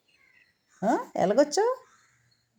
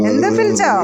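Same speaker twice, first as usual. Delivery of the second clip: loud, higher repeat of ఎందుకు పిలిచావు with stress on పిలిచావు; loud call; 5 semitones higher, 9 dB louder than usual. Acted. Owner calling her pet, loud and demanding. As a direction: call it out firmly. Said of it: "repeated after plain take".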